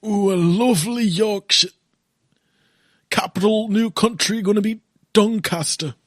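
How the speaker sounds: high exaggerated Yorkshire accent; hammed-up old Yorkshireman voice. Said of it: "That's what they say, isn't it?".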